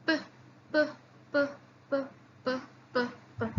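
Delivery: p repeated crisply with no voice; teacher leading a p drill. Shown as a demonstration. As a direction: beat out p again and again. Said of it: "Say it with me".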